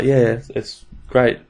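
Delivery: lightly voiced happy patient; a hint of someone else's voice; put-on quote voice. Patient answering whether she is happy with it, lightly put-on voice. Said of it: "why I'm saying in that voice".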